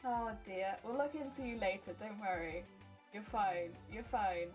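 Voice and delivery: ordinary talk, nothing marked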